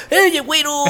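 put-on high, playful Mexican accent call; mock Mexican accent. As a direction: shout it like a Mexican buddy, Mexican accent. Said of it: "hablar el acento ese mexicano".

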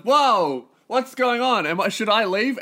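loud, high-pitched alarmed double take; startled. Retold or imitated. Comic retelling his shock at women undressing backstage, startled. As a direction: act out being caught off guard.

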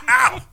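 high-pitched laugh yelp; sudden mock guffaw; imitated guffaw. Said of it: "when your laughter starts, it's this".

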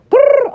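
short high mock growl; a quick cartoonish grr; playful growl. Vlogger growling at the gossip, playful high growl.

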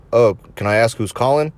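ordinary delivery

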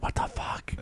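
breathy unvoiced whisper of disbelief; hushed what-the-fuck; whispered aside. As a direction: mutter it under your breath, stunned. Whispered aside in retold story.

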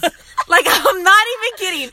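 can't stop laughing as she speaks; laughing while talking. Real, not acted. Friend laughing about her ex hitting her with balls, giggling speech.

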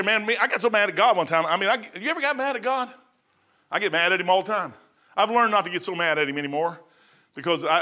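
ordinary talk

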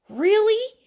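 high, loud incredulous question; can't believe his ears; disbelief. A narrator's reading. Friend hears the tree fell, high-pitched disbelief. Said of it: "said Buddy in disbelief".

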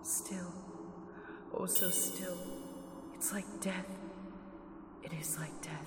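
soft repetitions sinking to a whisper; fading trance murmur. Acted. Entranced Mina murmurs of stillness, fading. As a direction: let each echo die away.

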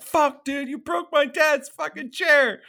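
high-pitched retold outrage; mock outraged. Trent yells at Chewie over dad's broken chair, outraged.

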